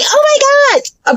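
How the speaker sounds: a high hammed-up gasp of outrage; mock outrage. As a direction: squeal it like a fake offended gasp. Mock complaint about neighbor's truck.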